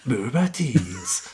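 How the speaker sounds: slow drawn-out trailer voice with a laugh; movie trailer voice. Shown as a demonstration. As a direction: stretch it like a cinema announcer. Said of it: "Con voz de locutor de película de cine".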